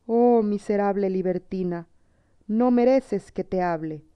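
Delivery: plain reading voice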